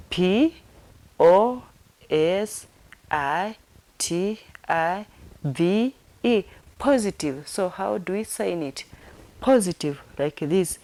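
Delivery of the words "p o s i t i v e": slow, separated letters; spelled out. Shown as a demonstration. Teacher spelling positive for sign learners, spelled out. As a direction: say each letter by itself with a pause. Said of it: "Let me repeat it again".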